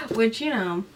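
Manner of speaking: ordinary talk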